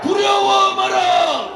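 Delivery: high, slow roared battle cries; rally the comrades, fearless and fierce; roaring rallying speech. Real, not acted. Rally speech climax, war cries. (포효하는 궐기 연설)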